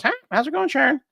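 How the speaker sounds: very high mock parrot voice; the parrot copying the husband, pitched very high. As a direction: squawk the greeting like a parrot. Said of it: "So now the parrot goes".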